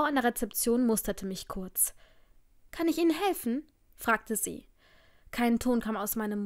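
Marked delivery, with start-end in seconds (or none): 2.8-3.7 high-pitched, courteous service question; polite receptionist. A narrator's reading. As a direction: ask helpfully in a higher voice. Receptionist addresses guest. (höfliche Empfangsdame mit hoher Stimme)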